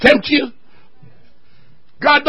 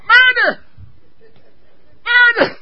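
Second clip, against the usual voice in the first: mock cry of murder; 10 semitones higher, 3 dB quieter, slower than usual. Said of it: "she starts crying out".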